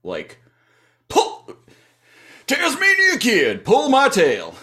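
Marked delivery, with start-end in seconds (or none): 1.1-4.6 high, loud mock demand; high-pitched, hammed-up yelling; cantankerous Bighorn. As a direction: order the kid about at full voice. Acting out Bighorn ordering the kid.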